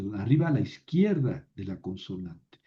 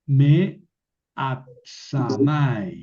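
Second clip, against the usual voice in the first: slow Hebrew reading; 4 dB louder, much slower than usual. Shown as a demonstration. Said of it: "las pronuncio juntas".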